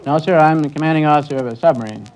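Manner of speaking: ordinary talk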